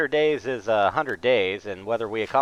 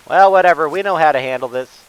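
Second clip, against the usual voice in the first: ordinary delivery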